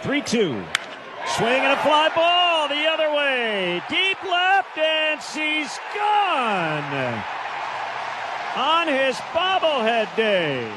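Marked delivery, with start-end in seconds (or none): 1.3-10.7 much higher, drawn-out, shouted play-by-play; high-pitched shout, stretching the big words; excited home run call. Real, not acted. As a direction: belt out the call as the ball leaves the park. Calls a home run live.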